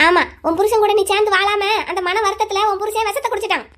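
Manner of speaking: usual speaking voice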